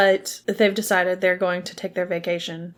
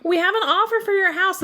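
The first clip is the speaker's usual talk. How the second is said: high, pushy saleswoman; pushy realtor voice; 11 semitones higher than usual. Retold or imitated. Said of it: "She's like".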